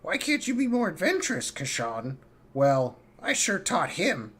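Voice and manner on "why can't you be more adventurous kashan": a hint of a nagging father, higher; lightly voiced scolding dad; mocking dad. Kashan mimicking his dad's nagging, mock scolding. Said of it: "He always tell me".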